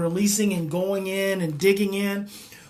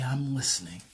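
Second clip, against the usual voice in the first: low, soft near-whisper; hushed, receptive reply; 7 semitones lower, 7 dB quieter than usual. Acted. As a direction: answer the little voice very softly. Answering the quiet inner voice.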